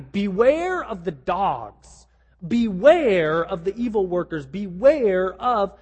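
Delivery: slow, weighty stress on each "Beware"; very emphatic. Acted. Preacher voices Paul's warning to the church, very emphatic. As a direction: hammer out each warning, stretching the words. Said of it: "very emphatically".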